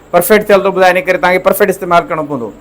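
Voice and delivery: usual speaking voice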